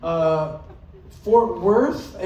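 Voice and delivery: ordinary talk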